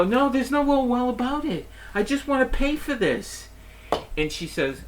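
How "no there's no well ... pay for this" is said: much higher, exasperated protest; indignant retort. Retold or imitated. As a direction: refuse her brush-off sharply. Customer snapping at the clerk.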